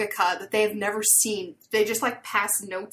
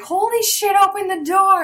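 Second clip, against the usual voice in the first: high, loud panicked yell through the glass; frantic shout; 10 semitones higher, 9 dB louder than usual. Retold or imitated. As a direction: shout to stop him locking up. Girl sees jellyfish shop closing.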